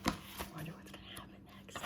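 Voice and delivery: fast hushed whisper; suspense whisper. Real, not acted.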